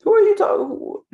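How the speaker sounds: a spirit baffled at a wrong name; offended spirit. Acted. Spirit hearing its name mispronounced, high indignant voice. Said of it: "the spirit's like".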